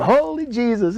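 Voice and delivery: very high mock exclamation; comic high-pitched astonishment; astonished Nigerian lady. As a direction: imitate her amazement, pitch leaping way up. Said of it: "it was so funny".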